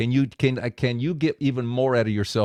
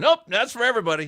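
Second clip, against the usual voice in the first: much higher and fast, delighted; delighted realization; 12 semitones higher, faster than usual. Retold or imitated. Preacher realizing the tour is for everyone, delighted.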